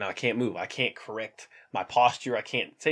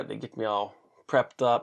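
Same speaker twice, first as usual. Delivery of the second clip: ordinary talk, nothing marked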